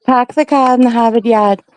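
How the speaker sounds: "pahk the cah", no R's; Boston accent, drop every R. Shown as a demonstration. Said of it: "so you don't say R's".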